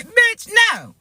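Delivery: sassy refusal. Real, not acted. Refusing demons at her door.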